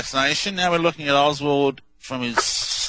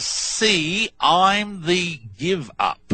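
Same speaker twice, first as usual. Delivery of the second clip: stretched-out words so the listener can catch them; slow, deliberate quote; 5 semitones higher, much slower than usual. Retold or imitated. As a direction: say it very slowly, word by word. Spelling out a reversal before playing it.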